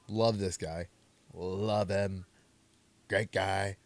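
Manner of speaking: a lightly voiced Trump, barely changed; hint of Trump bragging about a friend; mild Trump voice, close to his own; Trump impression. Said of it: "that's my Trump impression".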